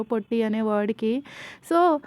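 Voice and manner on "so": usual speaking voice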